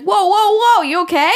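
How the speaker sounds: high, loud startled whoa; alarmed whoa. Retold or imitated. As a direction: call out in sudden alarm. Husband alarmed as she climbs stairs.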